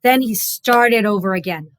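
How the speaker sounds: usual speaking voice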